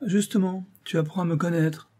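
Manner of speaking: high, taunting retort; mocking. A narrator's reading. Actress answering her jealous lover, mocking. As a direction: jeer lightly, pitched well up. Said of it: "railla la Gossin".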